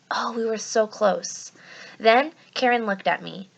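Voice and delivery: ordinary delivery, nothing marked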